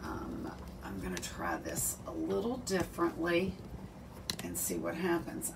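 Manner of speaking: whispered aside to herself; breathy whisper while fixing the camera. Real, not acted. Fiddling with the tripod, muttering.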